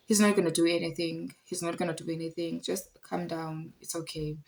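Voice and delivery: hushed, placating quote that tails off; retold reassurance. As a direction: imitate a neighbour quietly calming someone down. Neighbour's retold reassurance to her.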